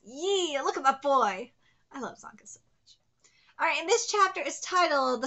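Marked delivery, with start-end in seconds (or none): 1.9-3.0 whispered fond aside; adoring whisper. Real, not acted. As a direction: whisper it like a smitten fan. Private gushing aside about a favourite.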